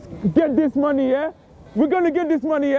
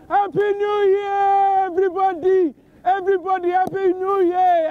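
celebratory yell; 5 semitones higher than usual. Real, not acted. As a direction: stretch the words out to the whole street. Countdown ends, celebrating New Year.

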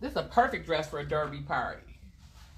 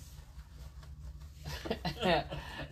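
laughing; 9 dB quieter than usual. Real, not acted. Host bursts out laughing between remarks.

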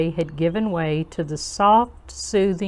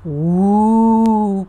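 long, loud ghostly "wooo"; drawn-out hollow spooky howl; spooky cave wail; 6 dB louder than usual. A narrator's reading. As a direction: wail like a haunted cave, with lots of expression. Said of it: "cried the deep, dark, mysterious cave".

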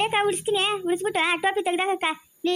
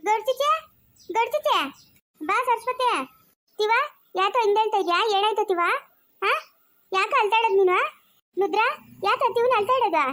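put-on high-pitched childish lisp; lisping childlike character; 4 semitones higher than usual. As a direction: pipe up high with babyish consonants. (ತೊದಲು ಮಾತಿನ ಮಗುವಿನಂಥ ಧ್ವನಿ)

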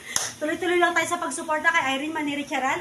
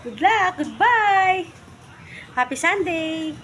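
cheery sign-off; 5 semitones higher, 6 dB louder, much slower than usual. Real, not acted. Signing off cheerily.

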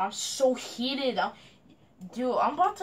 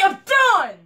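loud, higher furious cry; real shout of quitting in fury; angry shout; 7 semitones higher, 12 dB louder than usual. Real, not acted. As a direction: throw the words out in rage. Raging at teammate.

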